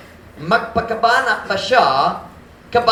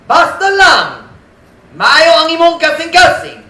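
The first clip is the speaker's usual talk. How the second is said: mocking quote; 9 semitones higher, 8 dB louder than usual. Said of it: "They say this".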